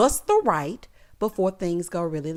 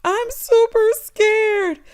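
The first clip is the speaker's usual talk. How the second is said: mock scared; 12 semitones higher, 5 dB louder, much slower than usual. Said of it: "She said".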